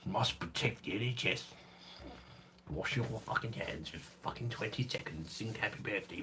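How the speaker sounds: soft, mumbled mock prime minister; Boris Johnson. Streamer mocks pandemic briefings, soft put-on politician voice. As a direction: bluster quietly through the orders like a bumbling politician. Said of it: "Boris impression".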